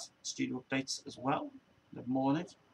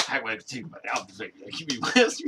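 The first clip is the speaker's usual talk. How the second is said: mock friend; 3 semitones lower, 6 dB louder than usual. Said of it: "she's just like".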